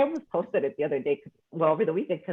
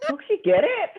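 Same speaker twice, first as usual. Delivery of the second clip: mock frustration; 13 semitones higher, 3 dB louder than usual. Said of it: "I was getting frustrated".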